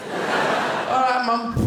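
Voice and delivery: put-on high, eager kid voice; child voice. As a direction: chirp it like a keen child.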